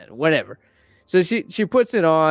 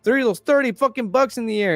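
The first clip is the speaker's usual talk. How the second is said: put-on a much higher, indignant girl voice; outraged teen girl; 10 semitones higher, faster than usual. Carly Beth yelling at the shop owner, high indignant girl. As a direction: squeak it out like a cheated teenager.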